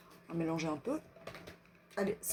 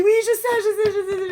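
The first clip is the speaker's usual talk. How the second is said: high, loud rush of repeats; exasperated high yell, imitating oneself; retold protest; 10 semitones higher, 7 dB louder, much faster than usual. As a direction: fend off a nagging knock, loudly. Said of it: "J'ai dit".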